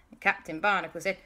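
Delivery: ordinary delivery, nothing marked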